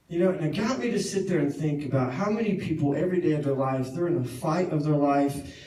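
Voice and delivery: steady, earnest preaching; sermon point. Acted. Preacher applying the story to the congregation, earnest. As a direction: drive the point home to the congregation.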